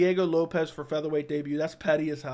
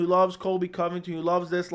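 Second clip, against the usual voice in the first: ordinary talk, nothing marked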